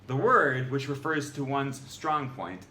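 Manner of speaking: ordinary talk, nothing marked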